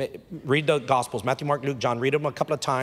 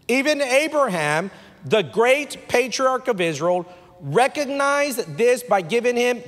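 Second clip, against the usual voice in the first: preached scripture reading; 7 semitones higher, 3 dB louder than usual. Acted.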